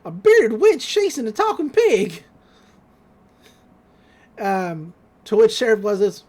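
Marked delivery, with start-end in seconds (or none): 0.0-2.2 high, loud cartoon voice; hammed-up excited deputy, pitched way up; Deputy Durlin. Deputy reports the chase to sheriff, high and excited. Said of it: "Deputy Durlin says".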